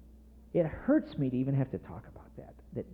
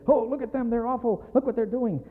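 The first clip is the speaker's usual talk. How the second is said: lightly acted, higher-pitched accusers; mock indignation; 6 semitones higher than usual. Pharisees pointing at disciples eating on the Sabbath, mock indignant. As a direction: put on a touch of scandalized fault-finding.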